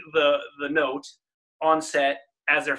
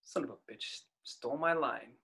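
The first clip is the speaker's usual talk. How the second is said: quiet quote; 4 semitones lower, 13 dB quieter than usual. Retold or imitated. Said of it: "End of the movie".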